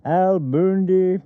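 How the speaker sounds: high, slow clerk reading out the name; DMV clerk mispronouncing. Retold or imitated. DMV clerk calling out Al's name, slow and high. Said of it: "he goes".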